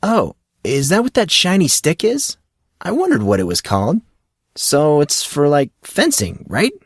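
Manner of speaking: a hint of an eager, clueless friend; curious young Monson. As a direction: ask it like a naive newcomer. Monson asks about fencing.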